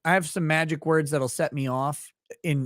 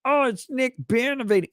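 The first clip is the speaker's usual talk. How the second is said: higher, sneering imitation of critics; mock accuser; 8 semitones higher than usual. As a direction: mimic a smug accuser. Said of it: "Like, it's".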